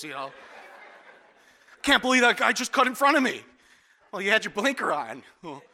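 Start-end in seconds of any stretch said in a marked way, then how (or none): 1.8-3.4 louder, huffy grumble at another driver; mock road rage. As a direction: play the indignant driver in traffic. Mocking an annoyed driver.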